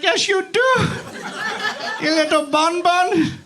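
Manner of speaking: teasing pet-name voice. Retold or imitated. Teasing his girlfriend after she says she doesn't talk dirty, high playful voice. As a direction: tease her like a naughty pet name. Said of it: "I'm like".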